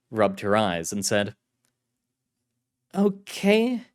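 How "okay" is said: female agent voice. Acted. Carlotta voiced.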